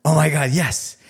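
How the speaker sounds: genuinely thrilled reply, acted out; excited. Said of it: "literally felt excited".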